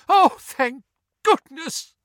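high, loud, slow tearful outburst; sobbing relief. A narrator's reading. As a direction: sob it out with huge relief. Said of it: "sobbed Missus Weasley".

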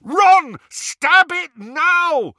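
high, drawn-out desperate bellow. A narrator's reading. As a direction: bellow it at full force. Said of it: "Harry bellowed".

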